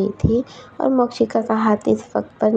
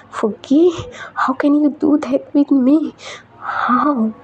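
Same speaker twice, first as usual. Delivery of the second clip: higher and slow, a hurt accusation; angry outburst; 6 semitones higher, much slower than usual. Acted. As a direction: cry out her name, then drag out the question. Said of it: "मुझ पर क्यों गुस्सा कर रही हो". (फुग्गी पर चिल्लाकर, धीमा और ऊँचा गुस्सा)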